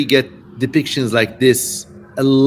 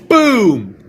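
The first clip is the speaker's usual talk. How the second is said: boom; 7 semitones higher, 5 dB louder than usual. Pretend. Punctuating the silencing.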